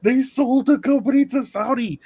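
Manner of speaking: high, hyped-up voice for the crowd; mock gossip panic. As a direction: imitate people freaking out online. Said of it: "was like".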